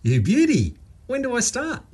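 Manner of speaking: a thrilled Aussie whoop at being hired; beaming joy. Acted. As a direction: burst out with delight at the news. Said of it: "Waka beamed".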